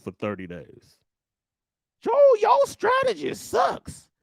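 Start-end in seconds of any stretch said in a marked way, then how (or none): 2.0-4.1 mock critic. Mocking critic voiced.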